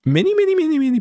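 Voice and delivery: many, many jumping up high in pitch; high emphatic build. Real, not acted. To viewers, stressing how many people objected, high-pitched.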